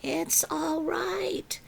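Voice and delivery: soft, slow reassurance; calm her down gently; calmly. A narrator's reading. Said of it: "Missus Willow said calmly".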